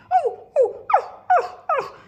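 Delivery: high, loud gasping oh's of fright; storyteller cries out as the snakes appear, high and loud; alarmed high cries. Acted. Scary snakes revealed.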